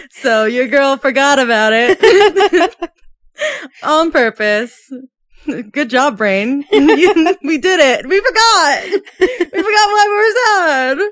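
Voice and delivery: giggling through the words, high and loud; laughing. Real, not acted. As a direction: laugh while talking. Laughing at forgetting the low.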